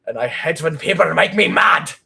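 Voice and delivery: loud hammed-up rage in a German accent; angry German. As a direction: blow up like a furious German character. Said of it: "very angry German character".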